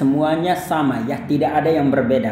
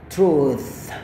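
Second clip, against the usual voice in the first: "truth" stretched out very slowly; slow demo. Shown as a demonstration. Teacher stretching the word for students, slowly. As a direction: drag the word out for learners. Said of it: "Truth, kayak gitu".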